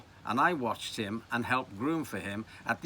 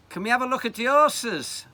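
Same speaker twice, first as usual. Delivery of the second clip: high, loud Scouse schoolboy; Liverpool accent; 12 semitones higher, 8 dB louder than usual. Acted. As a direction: ask eagerly in broad young Liverpudlian. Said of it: "in my best Liverpool accent".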